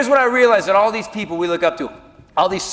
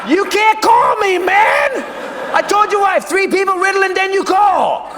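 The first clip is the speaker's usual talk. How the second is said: much higher, loud flustered yelling; panicked shout; 13 semitones higher, 5 dB louder than usual. Retold or imitated. Yelling high and flustered into the phone.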